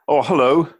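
high, bright telephone manners; posh phone voice. Retold or imitated. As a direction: answer the phone all polite. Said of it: "my parents always used to do that".

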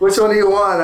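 high, loud lazy stoner voice; laid-back stoner. Retold or imitated. Stoned sandwich guy giving away cookies, high loud lazy voice. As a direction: shrug it off like a baked clerk.

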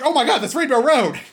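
high, loud burst of excitement; excited reaction. Retold or imitated. Host relives seeing the trailer's kart scene, excited. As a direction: exclaim it like a thrilled fan.

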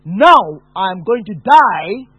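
loud, slow anguished cry; desperate shout of someone ready to die. Retold or imitated. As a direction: cry it out in despair, dragging each word. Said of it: "when I shouted out".

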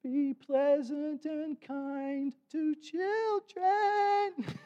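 put-on very high, very slow little girl's voice; little tiny voice. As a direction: squeak it out like a tiny granddaughter. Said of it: "this little tiny little voice".

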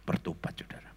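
hushed, breathy emphasis; whispered. Real, not acted. Preacher whispers to the congregation in awe.

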